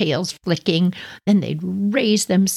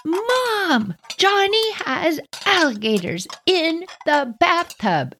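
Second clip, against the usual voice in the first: much higher, slow sing-song calling; drawn-out tattle call; 12 semitones higher, slower than usual. Retold or imitated. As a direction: stretch it out, calling down the hall. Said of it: "So I said it again".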